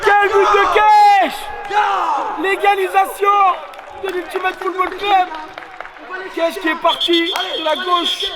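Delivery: high, shouted goal call; excited sports commentary. Real, not acted. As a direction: scream the goal with joy. Commentator calls the equaliser.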